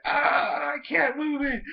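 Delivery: high, strained cartoon wail; mock distressed wail. Stuck Vivillon's trainer in trouble, high mock wail. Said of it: "the other one was like".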